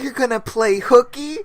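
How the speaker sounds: high surprised question; mock surprise. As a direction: ask it shocked and high. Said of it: "Adam's like".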